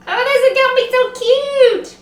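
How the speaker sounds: high, loud excited squeal of words; delighted. Real, not acted. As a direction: gush with delight, high-pitched. Delighted with her decoration.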